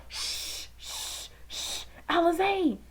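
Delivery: whispered shush, hushing a student; teacher shushing. Retold or imitated. Teacher shushing an eager student in a whisper. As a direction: hush her quietly with a whisper. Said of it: "She's like".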